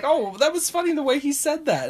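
high-pitched imitated remark; bright high retelling of a comment; acted comment. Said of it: "But I'll be like".

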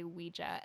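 Ouija said as WEE-juh; wee-juh pronunciation. Shown as a demonstration. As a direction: end Ouija on a soft "juh". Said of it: "make an effort to say".

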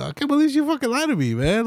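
high, fast mock outrage; high, fast mock of the wronged boyfriend. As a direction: squeal it like the betrayed boyfriend, fast. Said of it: "Your boyfriend is like".

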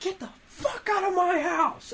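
high, cracking scream of an enraged mother; mock furious mom scream. Mom screaming at her son to get out. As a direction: shriek it like she's losing it.

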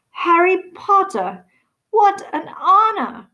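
high, slow, awestruck exclaim; nearly in tears with joy; overwhelmed delight. A narrator's reading. The tearful bartender greets Harry in high overwhelmed delight.

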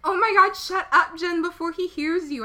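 high, loud screech. A narrator's reading. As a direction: shriek at him to hush. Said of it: "I screeched".

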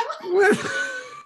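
high burst of laughter. Real, not acted. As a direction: laugh out loud. Laughs after joke.

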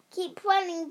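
high, urgent dog voice; barking dog. A narrator's reading. Guard dog urging Tessa to flee, barking. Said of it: "barked the dog".

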